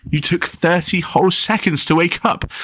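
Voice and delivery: put-on a small boy lecturing his sister; high little brother. A narrator's reading. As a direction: insist like a worried kid.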